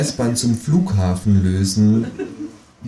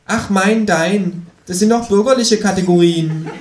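high, cheeky kangaroo voice; high, sulky kangaroo; 10 semitones higher than usual. Acted. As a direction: play the kangaroo, much higher than the narrator. Kangaroo's voice in the story.

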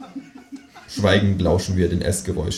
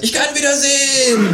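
high, laughing cry of joy; shouting kangaroo. Acted. Kangaroo cries out in joy outside, high and laughing. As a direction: rejoice kissing the ground.

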